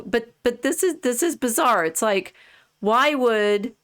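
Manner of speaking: normal talking voice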